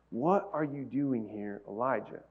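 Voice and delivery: soft, slow, hushed question; quiet voice of God. Shown as a demonstration. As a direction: ask it gently, almost a whisper. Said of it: "this is the second time that question was asked".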